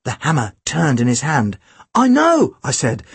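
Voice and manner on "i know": put-on high-pitched boy's voice; lightly acted boy, high; boy hero's reply. A narrator's reading. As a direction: answer back pitched well up. Boy hero answers back in dialogue.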